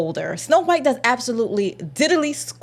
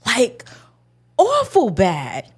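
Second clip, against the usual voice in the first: disbelief; 5 semitones higher, 3 dB louder, much slower than usual. Retold or imitated. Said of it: "I'm like".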